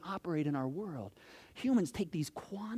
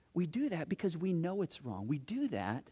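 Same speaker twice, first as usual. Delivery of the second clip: usual speaking voice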